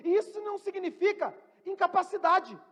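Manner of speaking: usual speaking voice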